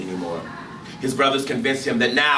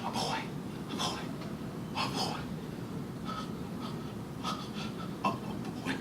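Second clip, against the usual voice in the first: dazed murmur; 9 dB quieter than usual. Acted.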